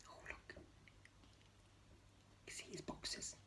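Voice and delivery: breathy unvoiced whisper. Real, not acted. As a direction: lean in and whisper the secret. Aside to children, whispering.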